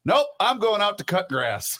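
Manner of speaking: high, abrupt mock refusal; imitating T K. TK turning down Pat's question, high mock voice. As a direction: shoot back a high-pitched, dismissive answer. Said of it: "And he says".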